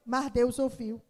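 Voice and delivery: ordinary talk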